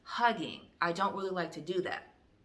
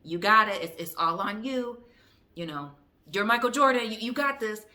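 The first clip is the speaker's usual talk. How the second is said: loud, higher-pitched hype; mock pep talk; 4 semitones higher, 8 dB louder than usual. Telling someone it's all on them, loud pep talk. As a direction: cheer them on loudly, like a coach from the sidelines. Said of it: "Whereas I'm like".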